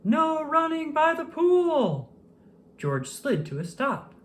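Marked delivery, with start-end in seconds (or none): 0.0-2.1 high, slow, loud lifeguard shout; strict lifeguard calling out. A narrator's reading. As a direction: bellow the pool rule from the tower. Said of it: "a loud voice call".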